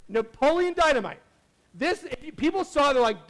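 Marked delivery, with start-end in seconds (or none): 0.1-1.2 title repeated louder and higher; big reveal of the sleeper hit; emphatic title reveal. Shown as a demonstration. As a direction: land the name like a punchline.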